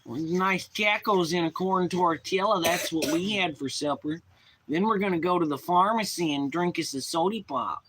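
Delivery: exaggerated Midwest accent, key phrase drawn out. Streamer showing chat he can almost do a Midwest voice, Midwest accent. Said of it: "Midwest thing".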